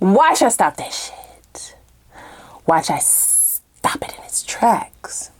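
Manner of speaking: slow, unvoiced sinister whisper; scheming whisper. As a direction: hiss it out like a plotting villain, slowly. Voicing the toxic person's scheming.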